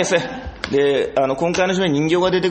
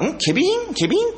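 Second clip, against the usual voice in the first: very high questioning repeat; acted bafflement, pitched way up; puzzled echo; 14 semitones higher than usual. Trainee puzzling over puppet jargon, high baffled questioning.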